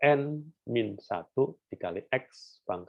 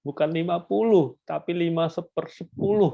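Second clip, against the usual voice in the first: higher, louder correction stressing "sepersepuluh"; corrective emphasis; 8 semitones higher, 5 dB louder than usual. Shown as a demonstration. Teacher to students, correcting a reading with higher, louder emphasis. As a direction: hit "sepersepuluh" hard against "lima puluh". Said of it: "Yang ini bukan".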